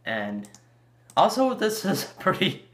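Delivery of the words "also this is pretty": breaks into a laugh, pitched up; amused laugh. Real, not acted. Fan reacting to the Rotom reveal with a laugh. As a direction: giggle at how odd it is.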